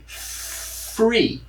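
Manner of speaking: free breathed without voice, F then ree; whispered free. Shown as a demonstration. Teacher showing students free with an F. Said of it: "com o som de F".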